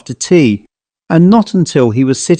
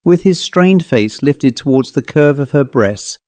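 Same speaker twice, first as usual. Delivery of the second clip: plain reading voice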